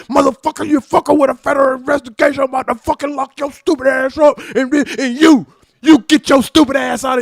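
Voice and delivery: loud, high furious shout. Retold or imitated. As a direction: bark it right in his face. Officer screaming in his face.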